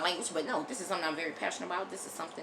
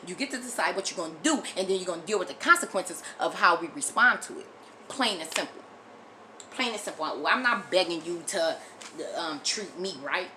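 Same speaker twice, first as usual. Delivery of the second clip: ordinary delivery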